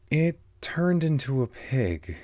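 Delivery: ordinary delivery, nothing marked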